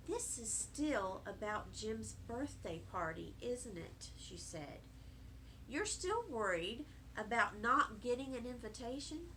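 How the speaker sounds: a hint of a soft, caring mother; gentle mother. A narrator's reading. Mother comforts child.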